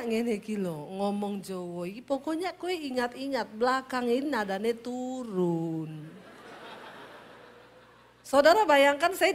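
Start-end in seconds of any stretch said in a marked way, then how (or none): 0.0-6.0 soft, slowing Javanese lilt, dropping at the end; Javanese lesson, falling tone. Retold or imitated. Husband teaching his wife to speak Javanese, soft falling tone. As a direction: sink gently onto a long drawn-out "turun". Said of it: "Lalu dia ajarin saya".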